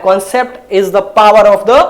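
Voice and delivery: concept is the power of the, each word drawn out slowly; slow catchphrase. Shown as a demonstration. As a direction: lay out the motto slowly, word by word. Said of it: "मैंने हमेशा से बोला है". (तकिया कलाम धीरे-धीरे, हर शब्द खींचकर)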